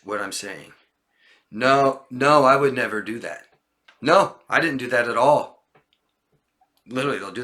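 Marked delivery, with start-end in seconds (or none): 1.5-5.7 insistent, louder denial; lying denial. Acted. Teacher playing a liar denying it, insistent. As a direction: deny it emphatically, protesting. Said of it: "you're just coming out saying the lie".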